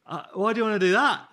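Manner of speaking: high, loud startled question; retold bafflement. Chef hearing of a call with Michelin's president, loudly baffled. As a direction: blurt the question out in mock alarm.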